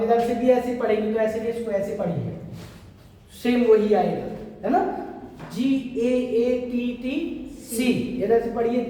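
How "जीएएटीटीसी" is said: letter by letter, slowly; slow spelling. Shown as a demonstration. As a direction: spell the bases out slowly for students. Spells out the base sequence. (धीरे-धीरे अक्षर-अक्षर बोलना)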